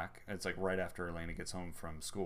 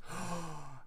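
high imitated gasp of wonder; big amazed intake of breath; astonished gasp; 10 semitones higher than usual. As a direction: gasp like an awestruck child. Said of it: "like, astonishment".

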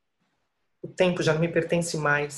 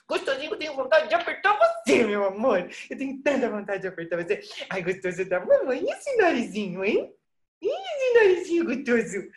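put-on doting baby talk; 5 semitones higher than usual. Mother cooing at her baby son, doting baby talk. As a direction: coo at the baby to coax him into playing.

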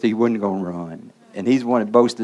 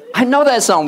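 laughing; 6 semitones higher, 4 dB louder than usual. Real, not acted. Laughs while preaching.

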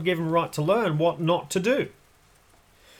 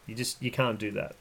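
ordinary talk, nothing marked

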